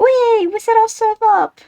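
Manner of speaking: high, giddy cheer; excited squeal. Real, not acted. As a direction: squeal with delight. Team cheers a good roll.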